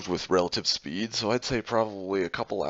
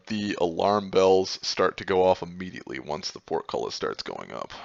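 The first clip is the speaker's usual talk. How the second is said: ordinary talk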